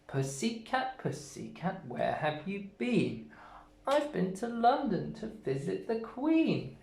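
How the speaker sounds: sing-song rhyme recital; slow sing-song rhyme; nursery rhyme. Shown as a demonstration. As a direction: recite it for little children. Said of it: "nursery rhyme recited".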